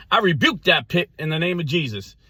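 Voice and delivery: high-pitched mock rebuke; hammed-up faith-healer declaration. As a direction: rebuke it like a fired-up Pentecostal.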